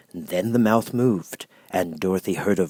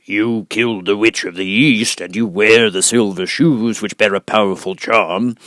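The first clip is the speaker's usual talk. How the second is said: great and terrible Oz; 8 dB louder than usual. Acted. Oz insists.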